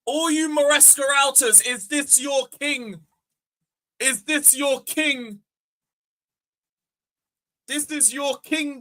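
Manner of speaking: slow, high drawn-out jeer; sing-song mock at rival fans; mocking taunt. Real, not acted. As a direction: rub it in slowly. Taunting rival fans about manager.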